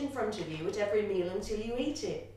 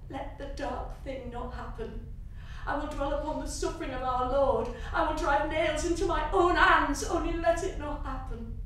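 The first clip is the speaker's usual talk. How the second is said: desperate prayer; 4 semitones higher, 9 dB louder than usual. A narrator's reading. Child's desperate silent prayer.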